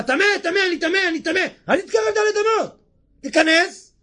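high warning cry of the leper. Acted. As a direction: cry it out high to keep people away. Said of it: "הוא אומר".

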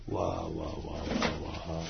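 very low, soft marvelling; hushed awe. Real, not acted. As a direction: breathe out awe under your voice. Marvels at midnight prayer.

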